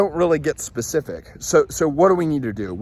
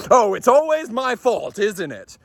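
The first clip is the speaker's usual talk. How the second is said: higher, sharp accusing whine; sudden exasperated outburst; 7 semitones higher than usual. Retold or imitated. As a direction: snap it out like a husband who flips instantly. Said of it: "went from a level one to a level ten".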